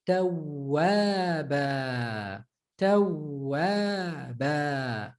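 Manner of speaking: Tawwaba drawn out slowly, holding the ww; emphasized waw. Shown as a demonstration. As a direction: hold the ww in Tawwaba. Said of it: "when it emphasizes a little".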